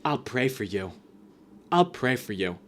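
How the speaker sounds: hushed smug parody of prayer; mock pious whisper. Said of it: "he's like".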